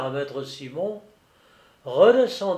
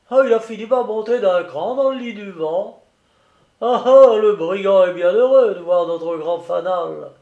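loud, higher character voice; excited old keeper; 5 semitones higher, 7 dB louder than usual. A narrator's reading. Keeper recognising the ship below, loud and excited. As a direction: exclaim it like a hearty sailor spotting a ship.

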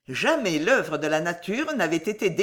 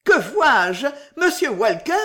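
loud, higher cry of joy; joyful exclamation; 6 semitones higher, 7 dB louder than usual. A narrator's reading. Job suddenly recognising Sam, crying out with joy. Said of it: "tressaillant de joie, il s'écria".

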